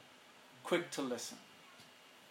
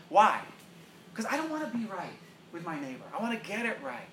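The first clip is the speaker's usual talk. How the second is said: ordinary delivery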